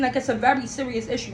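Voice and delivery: normal talking voice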